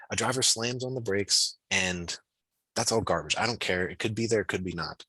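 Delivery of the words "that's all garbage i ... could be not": ordinary delivery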